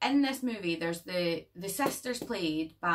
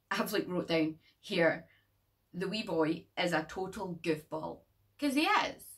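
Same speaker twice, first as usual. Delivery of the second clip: normal talking voice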